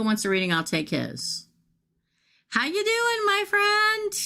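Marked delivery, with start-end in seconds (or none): 2.5-4.1 high, sing-song hello; high playful hello to a friend; cheery greeting. Real, not acted. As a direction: greet a friend with a bright lilt.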